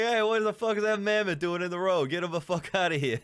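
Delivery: put-on loud, high New York accent shout; hammed-up New York street yell. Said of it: "trying to do a New York accent".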